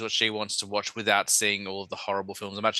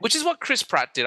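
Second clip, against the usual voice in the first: ordinary talk, nothing marked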